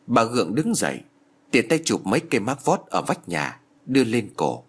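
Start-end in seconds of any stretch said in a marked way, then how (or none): none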